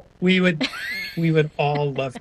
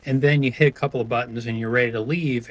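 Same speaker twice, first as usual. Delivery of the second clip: ordinary talk, nothing marked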